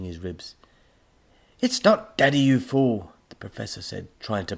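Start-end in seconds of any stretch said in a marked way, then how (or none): 1.6-3.1 loud, higher, cross correction; irritated outburst. A narrator's reading. Professor breaking free from robot, loud cross correction. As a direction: snap it loudly at a fool.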